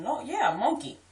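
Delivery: "monkey" high, as MAWN-key; insisting. Retold or imitated. Speaker arguing with husband, insisting on "monkey" as MAWN-key. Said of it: "And I'm saying".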